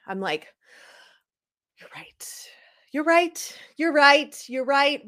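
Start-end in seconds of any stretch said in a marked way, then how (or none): 1.8-2.5 whispered grudging agreement. Retold or imitated. Fan conceding to her calming friend, whispered. 2.9-5.1 higher, louder mock-emphatic repeats; exaggerated agreement. Fan mock-agreeing with her friend, hammed-up emphatic.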